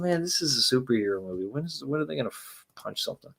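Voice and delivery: soft low grumble fading to a whisper; inner thought. Retold or imitated. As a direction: think it aloud, bored, trailing into a whisper. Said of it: "I'm thinking".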